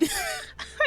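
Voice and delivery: high flustered giggle. Real, not acted. Streamer flustered mid-sentence.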